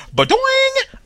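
very high springy boing noise; cartoon boing. Host's goofy sound effect as the thief gets an idea. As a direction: make a cartoon lightbulb boing.

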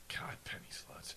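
unvoiced-sounding whisper to oneself; whispered aside. Real, not acted. Co-host marveling at penny slots, whispered aside. Muttered aside at overheard phrase.

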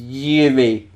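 put-on loud, high drunken holler. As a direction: slur it out like a drunk calling across a bar. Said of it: "in a pretty drunken voice".

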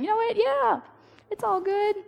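high, airy "it's all good"; mock breezy forgiveness. Preacher mocking easy forgiveness, high and airy. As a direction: toss it off as if nothing happened. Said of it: "It's not me up, up here saying".

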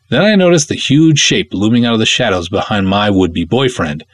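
plain reading voice

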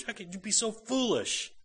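contemptuous. Retold or imitated. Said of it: "the contemptuous, think of the contemptuous and superior attitude".

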